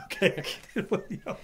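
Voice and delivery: laughing. Real, not acted. Husband laughs at his own admission.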